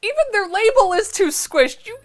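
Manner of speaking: high exasperated disbelief; incredulous outburst. Real, not acted. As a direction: rant at the squished font in amazement. Incredulous rant at wrong font.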